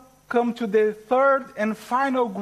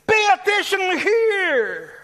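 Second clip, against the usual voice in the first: high, loud call for attention, easing in the middle, then booming at the end; emphatic attention-grabbing shout; emphatic John; 3 semitones higher, 3 dB louder than usual. Acted. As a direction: call out urgently as John, punching the final words. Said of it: "As if John were saying here".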